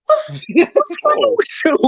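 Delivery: high squeaky disbelief; incredulous. Retold or imitated. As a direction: squeal it in mock shock. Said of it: "I looked at her and said".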